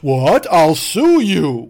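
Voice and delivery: mock outraged customer. Customer threatening the manager, slow and hammed-up indignant. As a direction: sputter a threat. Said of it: "customer threatens to sue".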